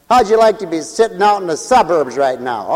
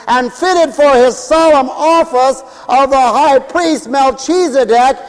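high, proclaiming pulpit voice; ringing sermon delivery, slowing at the end; fervent preaching; 8 semitones higher, 5 dB louder, slower than usual. Real, not acted. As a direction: declare the scripture to the whole church. Preaching at full force.

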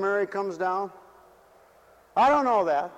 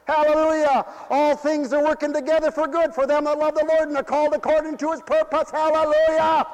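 full-force preaching; 9 semitones higher, 4 dB louder than usual. Acted. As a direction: preach it out with hallelujahs.

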